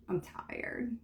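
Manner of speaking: breathy whisper of exhaustion; tired whisper. Acted. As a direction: whisper it, worn out. Voicing tired inner thought.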